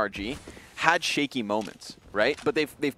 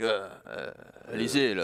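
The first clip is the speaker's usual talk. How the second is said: mock French team voice, lightly done; playful hint of a French accent; 2 semitones lower, 3 dB quieter than usual. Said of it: "That's the French in them".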